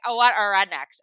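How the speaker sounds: loud, snooty mock voice; mocking the woman. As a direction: ham up a loud, sneering woman. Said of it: "she was like".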